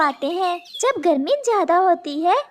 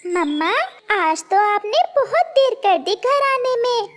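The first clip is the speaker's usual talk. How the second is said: a small chick voice, gently complaining; little chick asking why mum is late; child chick; 3 semitones higher than usual. Acted. Chick to his mother, a small child's voice.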